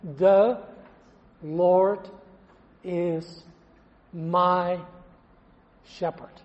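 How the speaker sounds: each word set apart like counting on fingers; slow, word-by-word count, last word breathed. Retold or imitated. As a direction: tick off every word on a finger. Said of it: "Just use the fingers of your left hand".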